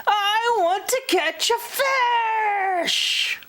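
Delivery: impatient shout. Acted. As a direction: yell it like a kid who won't wait.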